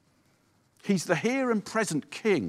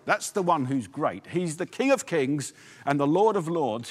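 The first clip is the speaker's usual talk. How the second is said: ordinary talk, nothing marked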